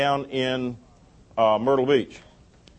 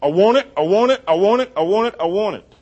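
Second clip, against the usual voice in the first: higher, louder, faster whining want; greedy flesh; 4 semitones higher, 3 dB louder, faster than usual. Acted. Preacher voicing the flesh's greed, whining demand. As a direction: whine like a greedy child. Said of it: "our flesh".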